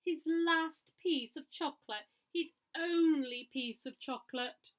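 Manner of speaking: slow, loud lament; slow, hammed-up despair; dramatic dismay. A narrator's reading. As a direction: mourn the lost chocolate.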